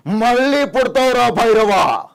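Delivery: slow, high-pitched climactic bellow; film-star impression. Mimic cries to Bhairava at the climax, slow roaring declamation. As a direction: roar the vow, dragging out the name. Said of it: "శ్రీగారు".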